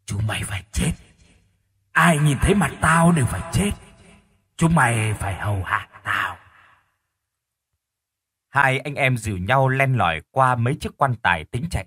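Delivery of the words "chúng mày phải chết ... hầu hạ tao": menacing corpse voice, rising and falling; raspy echoing hag. Acted. Corpse wife threatening the brothers, raspy menace. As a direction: threaten them like a vengeful hag. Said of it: "Tiếng hét khàn khàn mà âm vang".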